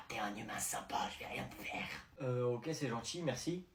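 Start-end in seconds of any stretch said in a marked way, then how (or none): 0.1-1.9 hushed voice in his head; eerie whisper. Acted. The voice inside his head whispers to him, eerie. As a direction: whisper it like a thought.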